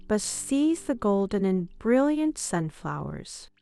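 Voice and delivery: usual speaking voice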